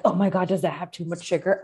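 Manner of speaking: ordinary talk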